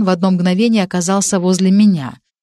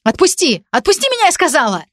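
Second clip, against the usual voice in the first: much higher, crying out to be let go; girl in a painful grip crying out, much higher; pained cry; 9 semitones higher than usual. Acted. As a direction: yelp it as the grip hurts. Скорчилась и даже вскрикнула.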